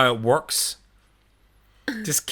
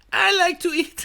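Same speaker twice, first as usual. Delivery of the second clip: much higher, laughing fake Italian; mock Italian accent; 4 dB louder than usual. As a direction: squeal it in a silly Italian voice. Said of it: "trying to keep it Italian".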